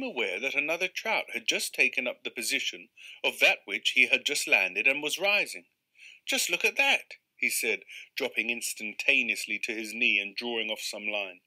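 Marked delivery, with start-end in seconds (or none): 6.2-7.2 higher and louder, thrilled angler; eager fisherman's cry; excited. Acted. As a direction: point out the trout with glee. Angler spots another rising trout.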